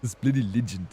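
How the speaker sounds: lightly put-on British accent, bloody stressed; mock Brit saying This bloody legend; imitated British accent. Shown as a demonstration. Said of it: "It's Inception accent".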